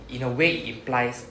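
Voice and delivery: normal talking voice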